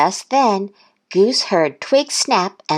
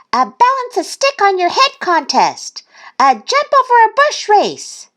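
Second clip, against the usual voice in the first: high, loud little-duck enthusiasm; excited; 5 dB louder than usual. A narrator's reading. Thistle proposing games to Goose, high excitement. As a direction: rattle off contests in a high voice.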